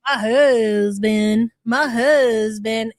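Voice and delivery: drawn-out, slow mock lament; mocking imitation. Host mimicking the woman lamenting about her husband, slow mocking imitation. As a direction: stretch each word in a mocking echo. Mimics the played clip's wail.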